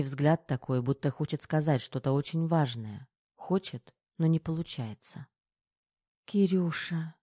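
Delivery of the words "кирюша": slightly raised, aching sigh of a name; half breathe, half moan it; understated moan on an out-breath. A narrator's reading. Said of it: "То ли выдохнула, то ли простонала Тома".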